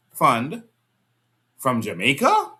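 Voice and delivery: ordinary talk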